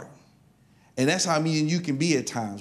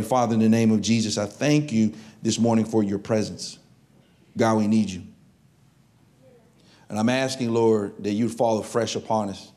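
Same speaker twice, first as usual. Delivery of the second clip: prayer; 2 semitones lower than usual. Acted. Pastor leads opening prayer.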